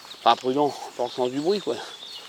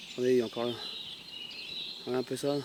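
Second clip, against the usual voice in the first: hushed; 5 semitones lower, 18 dB quieter than usual. Real, not acted. Filming a deer, keeping quiet.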